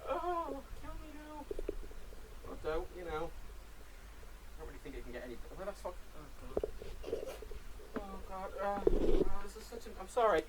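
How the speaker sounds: distant and very faint; far from mic. Real, not acted. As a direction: talk from across the room. Headset fell off, voice distant.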